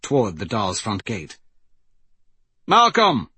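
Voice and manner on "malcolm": loud, high call; shout. A narrator's reading. Wraith summons Malcolm while grabbing his spear, shouting. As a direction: call him over from a distance. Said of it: "Wraith shouted".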